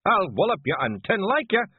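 high, loud Scots threat; yelling. A narrator's reading. Clan chief threatening Big Yan to his face, yelling. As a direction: square up and yell. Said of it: "yelled Rob Anybody".

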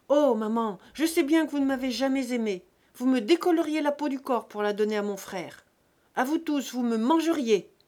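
loud, high, wounded accusation; bitter angry outburst. A narrator's reading. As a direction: hurl the grievance at a parent. Buteau's rage turned on his mother.

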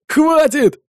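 high, urgent cry; alarmed exclamation. A narrator's reading. Brother sees his twin dropped, alarmed exclamation. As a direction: cry out to stop them. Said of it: "воскликнул Фернандо".

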